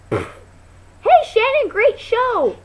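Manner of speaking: high, loud, drawn-out congratulation; mock gushing shout. Imitating friend about to cream her face, shouted. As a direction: holler it as cover for an ambush.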